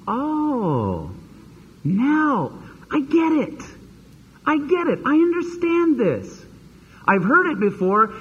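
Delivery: high, slow, wondering "I get it" as the light goes on; sudden realization. Retold or imitated. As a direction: imitate someone finally understanding, pitch climbing. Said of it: "it's like a switch goes on".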